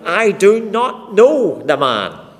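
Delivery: loud, slow, forceful denial; emphatic oath. Acted. Peter swearing to the bystanders he doesn't know Jesus, loud and slow. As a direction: hammer out each word, swearing he's innocent. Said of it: "he denies with an oath".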